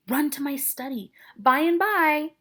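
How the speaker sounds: high, urgent hiss rising to a loud call. Acted. Friar stalling whoever is knocking, urgently calling out. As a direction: hurry him off, then call to the door.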